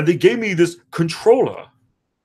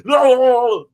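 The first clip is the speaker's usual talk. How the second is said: silly singsong; 5 semitones higher, 5 dB louder than usual. Retold or imitated. Button mashing chant.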